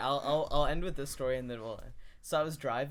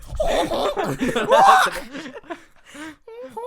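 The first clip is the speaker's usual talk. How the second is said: elephant noise; 12 dB louder than usual. Pretend. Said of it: "Okay, Tarv, your turn".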